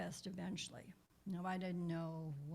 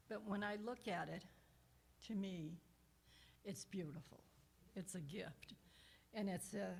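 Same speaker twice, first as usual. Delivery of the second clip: ordinary talk, nothing marked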